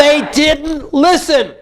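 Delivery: slow, high angry cry; modelled outrage. Shown as a demonstration. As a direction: hammer each word as an accusation. Coach models venting.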